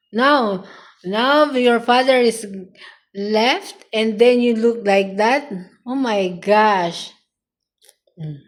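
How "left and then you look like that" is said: ordinary talk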